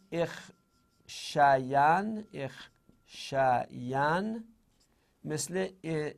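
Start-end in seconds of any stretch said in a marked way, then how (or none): 0.1-2.2 drawn out. Shown as a demonstration. 2.3-4.4 drawn-out repeat. Shown as a demonstration.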